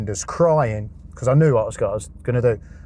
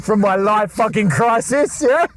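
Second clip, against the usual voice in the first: mock hopeful; 9 semitones higher, 3 dB louder than usual. Retold inner thought, hyped up.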